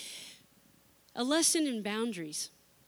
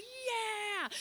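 put-on cheering teens; 11 semitones higher, 5 dB quieter than usual.

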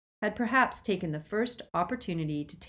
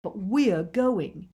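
slow, louder, thrilled; exhilarated; 3 semitones higher, 5 dB louder, much slower than usual. A narrator's reading. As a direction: let the thrill burst through, drawing it out. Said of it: "unable to suppress the exhilaration".

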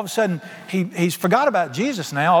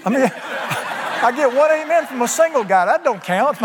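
higher, laughing through the words; teasing the single guy while laughing; 8 semitones higher than usual. Real, not acted. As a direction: chuckle through the joke. Jokes about the lone amen.

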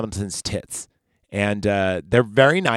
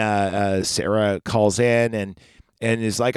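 ordinary delivery

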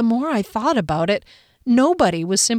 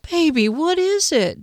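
put-on higher, boyish plea; boyish pleading; 8 semitones higher than usual. A narrator's reading. Ex-boyfriend pleading as she pulls away. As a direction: plead like a sulky boy. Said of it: "in his boyish pleading tone".

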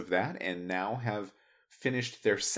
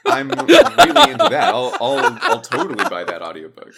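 louder, laughing tease; 3 semitones higher, 11 dB louder than usual. Real, not acted. Host teasing the guest about his accent, laughing. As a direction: tease him while cracking up. Joking about Huckleberry Finn audiobook.

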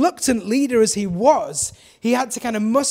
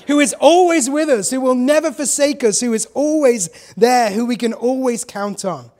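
rousing the congregation; 3 semitones higher, 5 dB louder than usual. Real, not acted. Pastor reviving the flagging congregation, fired up. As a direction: lift the room back up. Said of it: "we're losing enthusiasm here".

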